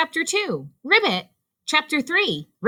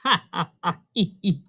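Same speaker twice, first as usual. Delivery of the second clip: choppy acted ha-ha and hee-hee; light staccato laughter; chuckle; 3 semitones lower, faster than usual. A narrator's reading. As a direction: chuckle in separate short bursts. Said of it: "chuckled Robot".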